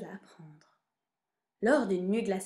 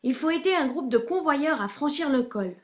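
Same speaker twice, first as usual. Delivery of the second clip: raised, higher voice, rallying the dog; calling out; 6 semitones higher, 6 dB louder than usual. A narrator's reading. Benno rallies his dog for a rescue job, calling out. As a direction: call loudly to get going. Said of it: "cria Benno".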